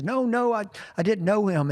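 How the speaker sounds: a hint of panicky denial; nervous denial.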